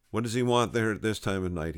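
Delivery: ordinary delivery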